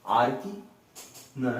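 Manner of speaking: ordinary talk, nothing marked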